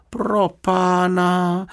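high, long drawn-out cry of alarm; exclaiming. A narrator's reading. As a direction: cry out high, stretching the words. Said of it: "vykřikl Jonatán". (vysoký protáhlý výkřik)

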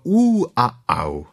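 slow, drawn-out squeal and yelp. Acted. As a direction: whimper "Ú a au" slowly. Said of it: "křičelo". (pomalé kvílení)

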